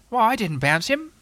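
high-pitched, defensive animal voice; lifted, protesting character voice; protesting Tigger. A narrator's reading. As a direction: deny it with injured innocence. Tigger denies the accusation.

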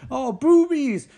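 high, loud, goofy excitement; hammed-up gawping delight; freaking out. Guys freak out on seeing a woman, giddily excited.

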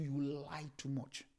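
usual speaking voice

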